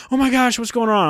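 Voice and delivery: high-pitched concerned imitation; alarmed store employee. Voices concerned female store employee.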